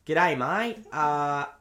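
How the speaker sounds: a light put-on Aussie shop clerk; lightly put-on Australian accent. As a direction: give directions in a mild Aussie drawl. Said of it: "I think that's better".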